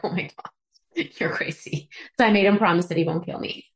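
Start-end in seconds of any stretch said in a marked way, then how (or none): 0.0-1.9 hushed disbelief. Retold or imitated. Boyfriend's reaction retold.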